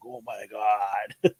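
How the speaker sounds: slow, imitated disbelief; stunned son. Speaker quotes younger son watching the episode, stunned. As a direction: draw it out like an awestruck kid. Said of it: "My younger son is like".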